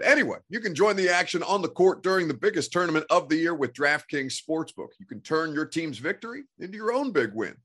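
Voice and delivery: upbeat sponsor read. Acted. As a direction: sell it like a radio ad. Host reads ad.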